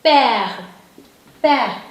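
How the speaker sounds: père as "pehr", one falling syllable; French pronunciation. Shown as a demonstration. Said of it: "And this is silent letter".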